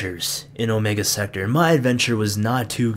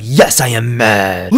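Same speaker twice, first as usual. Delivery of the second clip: mock mad; 4 dB louder, slower than usual. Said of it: "You mad, bro?".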